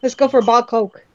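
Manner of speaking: put-on fast, run-together Newfoundland accent; fast, thick Newfoundland accent that friends can't follow. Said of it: "what the hell did you just say?".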